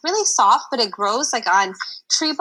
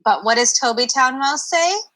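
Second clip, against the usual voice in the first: ordinary delivery, nothing marked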